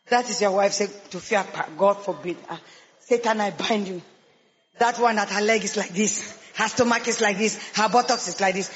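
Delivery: low, gruff mock voice of a choosy man; mocking suitor. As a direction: drop the voice low and sneer at the woman. Man mocking woman he rejects.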